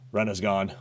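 usual speaking voice

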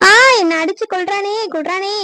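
high, loud wail of protest; outraged outcry. Acted. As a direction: cry out for everyone to hear. Wife cries out that she's attacked.

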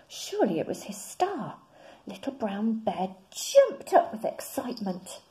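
ordinary delivery, nothing marked

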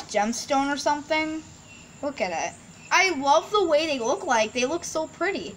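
ordinary talk, nothing marked

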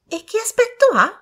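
put-on very high, loud girlish question; eager young ladies. A narrator's reading. Young ladies asking the servant, eager high chorus. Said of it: "domandarono le signorine Ashton a un fiato".

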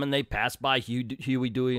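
ordinary talk

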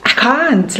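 ordinary talk, nothing marked

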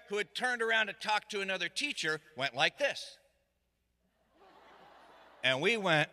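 normal talking voice

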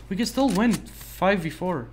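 normal talking voice

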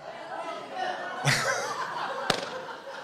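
a high, unvoiced laugh; breathy laugh. Real, not acted. Storyteller laughing at the audience's response. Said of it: "It's going to be a long night".

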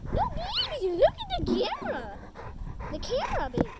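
high, loud sing-song coaxing. Real, not acted. Coaxing the baby to look at the camera, high baby talk. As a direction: coo loudly to catch her eye.

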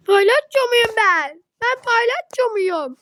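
high, loud, slow mock outrage; indignant whine. Girl asks if she's a clown, indignant whine. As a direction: demand it like an offended child. Complaining about clown-like socks.

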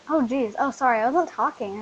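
loud, flustered apology; flustered outburst. Real, not acted. Gamer realizing she forgot the recording, loud flustered sorry. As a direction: blurt out sorry, caught off guard.

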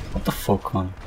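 voiceless, soft what the fuck; hushed disbelief; whispered curse. Real, not acted. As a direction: breathe it out under your breath. Killed in game.

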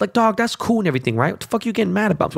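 exasperated disbelief. Real, not acted. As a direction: throw it back at them in disbelief.

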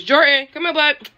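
high, loud pet-calling voice; calling the dog. Real, not acted. Owner calling the dog over mid-vlog, high and loud. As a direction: call your dog over brightly and loudly.

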